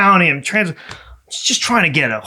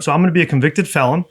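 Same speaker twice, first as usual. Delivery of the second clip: normal talking voice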